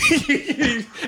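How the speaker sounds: high laugh at his own joke; burst of laughter. Real, not acted. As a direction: crack up at the punchline.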